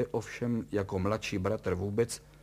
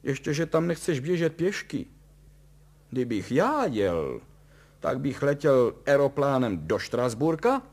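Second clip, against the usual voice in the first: raised, loud jeering; mocking; 6 semitones higher, 7 dB louder than usual. A narrator's reading. Son outdoing his father's rescue plan, mocking. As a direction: taunt with voice lifted. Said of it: "posmíval se Tonda".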